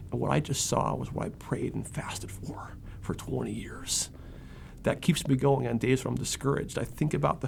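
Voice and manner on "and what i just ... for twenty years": whispered, slowing at the end; hushed awe. Retold or imitated. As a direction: whisper it like a holy secret. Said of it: "he said".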